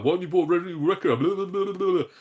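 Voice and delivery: rapid nagging that runs into gabble; mock pestering. Mimicking fans who hound him to buy the game, mock nagging. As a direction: imitate a pushy fan, speeding into babble.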